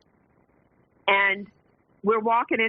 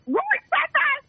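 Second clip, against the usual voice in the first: very high, laughing disbelief; high incredulous squeak; amazed question; slower than usual. Retold or imitated. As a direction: squeal it out in mock shock.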